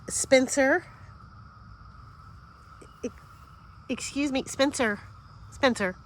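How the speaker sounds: sharp scolding call for the dog. Real, not acted. Calling dog caught being naughty.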